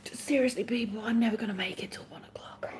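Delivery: tired, confiding whisper; weary whispered plea. Real, not acted. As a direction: whisper the confession. Confessing tiredness to chat.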